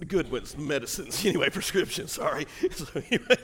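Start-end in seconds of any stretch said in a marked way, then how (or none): 1.3-3.4 laughing. Real, not acted. Joking about taking drugs.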